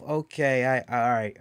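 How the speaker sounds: usual speaking voice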